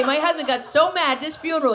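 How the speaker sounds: ordinary delivery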